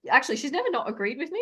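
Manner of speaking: ordinary talk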